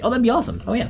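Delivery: high, delighted exclamation; genuinely thrilled; excited. Real, not acted. Excited reply to viewer offer.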